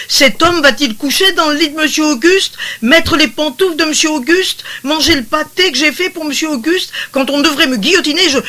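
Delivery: high, loud, rushing indignation; indignant outcry. A narrator's reading. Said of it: "s'écria la femme de charge".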